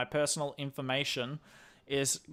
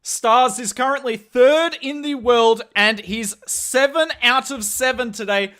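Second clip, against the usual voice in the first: louder, higher caster voice; live esports play-by-play; 5 semitones higher, 6 dB louder than usual. Real, not acted. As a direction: cast the attack live with mounting energy at big moments. Casts the attack live for stream.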